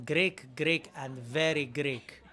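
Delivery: put-on loud, jokey Greek accent. Actor describing character in three words, loud Greek accent. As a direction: ham up the Greek accent, stretching it out. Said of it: "so I speak with an accent".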